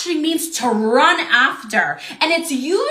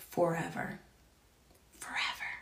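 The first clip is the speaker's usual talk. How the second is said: fading whisper; 8 semitones lower, 17 dB quieter than usual. Real, not acted. Quoting psalm, fading out.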